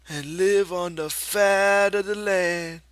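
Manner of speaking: put-on high and slow childlike voice; dreamy Lenny. A narrator's reading. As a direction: savour each word like a child dreaming. Lenny's voice in the story.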